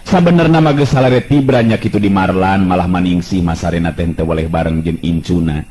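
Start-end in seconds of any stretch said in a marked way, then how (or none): none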